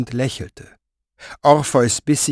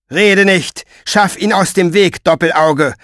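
higher, louder impatient bark; impatient shout; 6 semitones higher, 4 dB louder than usual. Acted. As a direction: snap at him to hurry. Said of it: "rief der Pfeifer ungeduldig". (Pfeifer treibt Orpheus ungeduldig an, lauter und höher)

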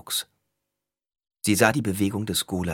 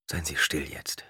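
low, quiet reassurance; soft and calming; 3 semitones lower, 7 dB quieter than usual. A narrator's reading. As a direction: hush her gently.

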